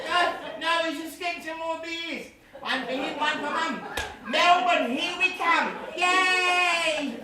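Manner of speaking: put-on high, excited child voice; eager little boy. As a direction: cheer like a kid on holiday. Boy on family holiday.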